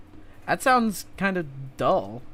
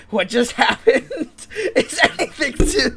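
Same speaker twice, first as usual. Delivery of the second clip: laughing; 8 semitones higher, 6 dB louder, slower than usual. Real, not acted. Laughing through reaction to game.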